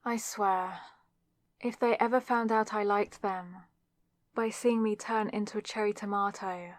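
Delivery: normal talking voice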